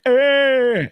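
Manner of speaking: a short, high, hammed-up groan of someone keeling over; mock dramatic collapse groan. Mocking a dramatic collapse, a high hammed-up groan. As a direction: ham up a theatrical dying "Eh". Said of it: "It wasn't no dramatic".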